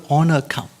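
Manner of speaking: ordinary delivery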